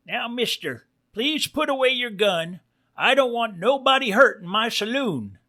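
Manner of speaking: high-pitched, calm cartoon bartender; calm barkeep pig. A narrator's reading. Barkeep asks wolf to put gun away, calm high voice. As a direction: keep it high and politely calm behind the shotgun. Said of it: "calmly says".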